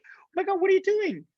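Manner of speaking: high, excited retold exclamation; amazed. Catching up with long-lost friend at gala, amazed. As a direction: gush the question in happy astonishment.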